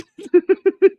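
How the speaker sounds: shrieking laugh at the memory; real high cackle of laughter; high squealing laugh. Real, not acted. Laughing at own story.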